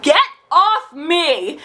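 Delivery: slow, high, drawn-out "get off me"; disgusted brush-off. Retold or imitated. Pushing his hands off her legs, disgusted. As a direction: stretch it out, grossed out.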